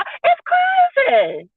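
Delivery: high, slow stretched exclamation; drawn-out shout. Real, not acted. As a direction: stretch it out and holler. Calling the wife crazy.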